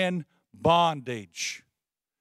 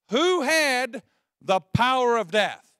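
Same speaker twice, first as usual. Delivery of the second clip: normal talking voice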